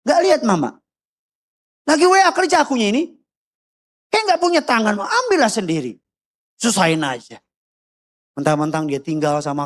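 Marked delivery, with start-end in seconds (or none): 0.0-7.4 high, exasperated complaint sinking to a grumble; whining child's retort. Retold or imitated. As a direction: gripe back at mother, put upon. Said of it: "kau bilang". (anak menggerutu kesal kepada ibunya)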